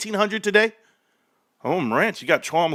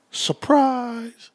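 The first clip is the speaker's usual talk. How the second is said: playful reveal; 6 semitones higher than usual. Real, not acted. Reveal to viewers.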